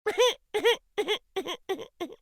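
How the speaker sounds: laugh. Acted. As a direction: break into a bright laugh. Laugh amid story narration.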